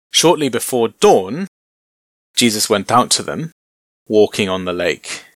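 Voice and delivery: normal talking voice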